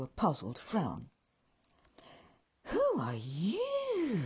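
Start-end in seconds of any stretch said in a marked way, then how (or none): 2.7-4.2 high, slow, shocked elf echo; drawn-out higher elf voice, aghast; shocked tones. Acted. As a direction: elf repeating the question in high shocked disbelief. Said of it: "repeated my words in shocked tones".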